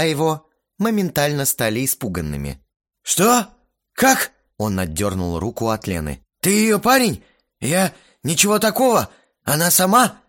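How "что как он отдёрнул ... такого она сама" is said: high, loud, startled babble; panicked. Acted. Frightened guy protests to the hero, loud and panicky. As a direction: scramble out excuses in panic. Scared guy wakes beside Lena.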